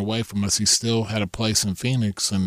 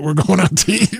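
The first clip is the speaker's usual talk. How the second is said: higher, louder, gleeful; 7 semitones higher, 6 dB louder than usual. Retold or imitated. Telling wife Dana they'll dine on his winnings, gleeful. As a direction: crow it out.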